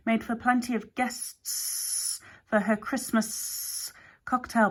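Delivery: slow, whispery drawn-out snake; a sly snake confessing at half speed; snake Hex, confiding. A narrator's reading. Snake Hex admitting the secret to Alex, slow and sly.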